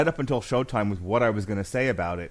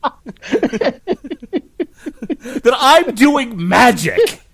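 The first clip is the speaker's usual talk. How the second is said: laughing; 10 semitones higher, 11 dB louder, slower than usual. Real, not acted. Laughing at people's podcast reactions.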